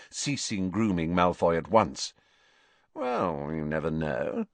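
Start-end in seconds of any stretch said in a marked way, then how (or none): 2.9-4.5 coy, with a hint of a smirk; understated smugness; slight smirk. A narrator's reading. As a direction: let a faint smirk into it. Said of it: "with the ghost of a smirk".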